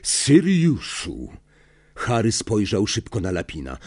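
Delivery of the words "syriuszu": very slow, strained question; tense, drawn-out questioning; barely restrained emotion. A narrator's reading. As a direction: hold back strong feeling, drawing out each word. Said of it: "drżący od z trudem powstrzymywanych emocji".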